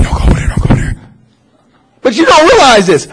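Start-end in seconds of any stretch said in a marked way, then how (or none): 0.0-1.0 whispered warning, no voice at all; urgent whisper. Retold or imitated. Imitating Gollum whispering a warning, urgent whisper. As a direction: hiss the warning under your breath. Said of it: "He, like, whispering".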